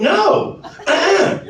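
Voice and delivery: high-pitched indignant no; retold offended refusal; firm refusal. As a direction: shut it down with comic finality. Husband refuses wife's advances.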